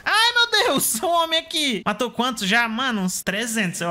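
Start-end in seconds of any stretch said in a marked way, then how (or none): 0.0-1.8 high, loud startled cry. Real, not acted. Gamer startled by an enemy suddenly close.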